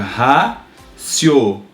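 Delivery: "raciocínio" said slowly, syllable by syllable; teacher splitting "raciocínio" into syllables; split into syllables. Shown as a demonstration. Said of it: "Separar silabicamente".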